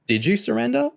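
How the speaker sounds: high, mock-innocent question; grinning taunt. A narrator's reading. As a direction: tease with a grin. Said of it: "Kerry grinned".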